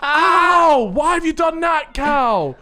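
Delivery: high, loud fake-hurt cry, easing off by the end; mock pained wail. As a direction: wail accusingly as a sister faking injury. Sister fakes being hit.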